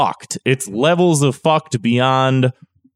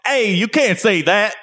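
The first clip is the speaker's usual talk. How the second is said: alarmed shout; 8 semitones higher than usual. Pretend. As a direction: yell to stop them. Mock alarm at slur.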